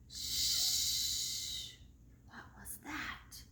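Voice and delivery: hushed storyteller whisper. A narrator's reading. Narrator whispering to young listeners, hushed. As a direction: lean in and whisper the mystery.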